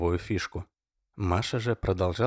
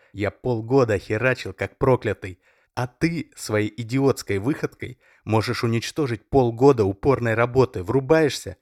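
louder threatening rant; menacing; 4 semitones higher, 6 dB louder than usual. A narrator's reading. Oleg threatens Masha she won't leave, louder and menacing. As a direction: intimidate her.

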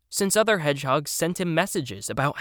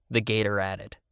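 usual speaking voice

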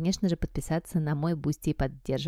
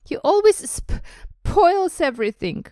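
high, loud fake sobs, stuttering; pretend sobbing yell; 12 semitones higher, 7 dB louder than usual. A narrator's reading. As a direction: wail it like a spoilt child faking tears. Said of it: "yelled between huge pretend sobs".

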